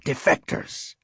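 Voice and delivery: hushed raspy whisper; rasping. A narrator's reading. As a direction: rasp it out with almost no voice. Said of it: "Showalter rasped".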